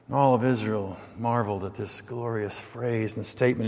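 ordinary talk, nothing marked

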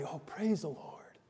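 hushed, reverent mock reaction; soft acted piety; real quiet. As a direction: murmur it softly, as a congregation gone quiet. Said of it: "get real quiet and say".

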